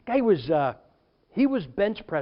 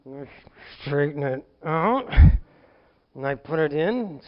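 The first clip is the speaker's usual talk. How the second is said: laborious effort; 4 semitones lower, 4 dB louder than usual. Retold or imitated. Pastor acting out the dipstick repair, laborious effort. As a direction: stretch it out as if working it straight.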